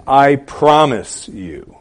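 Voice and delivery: slow, weighty "I promise you"; "I promise you" said slowly and firmly; emphatic promise. Acted. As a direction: lean hard on "promise", drawing it out. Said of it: "he's being emphatic".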